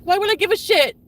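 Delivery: loud, high-pitched furious question; real angry outburst. Real, not acted. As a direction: throw it out as an angry shout. Angry rant about partner's betrayal.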